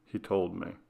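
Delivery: plain reading voice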